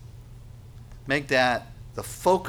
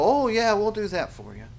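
glib promisers; 10 semitones higher than usual. Acted. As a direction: agree too readily, then ease off. Dignitaries glibly promise the seeker peace.

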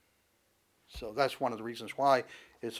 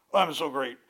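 mock boast; 5 dB louder than usual. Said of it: "this great warrior".

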